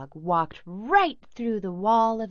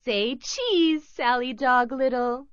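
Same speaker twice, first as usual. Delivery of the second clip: Twinkle; 5 semitones higher, 3 dB louder than usual. A narrator's reading. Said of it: "said Twinkle".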